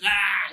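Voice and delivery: short mock scream of rage; hammed-up outburst of anger; angry yell. Showing how he does not react to bombing, mock yell. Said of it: "I don't think it's so much".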